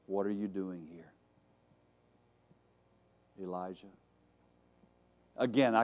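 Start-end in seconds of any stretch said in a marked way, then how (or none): 0.1-3.9 soft, low question, long pause before the name; hushed voice of God; small gentle voice. Acted. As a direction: ask it very gently, almost under the breath. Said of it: "that small voice, that gentle voice".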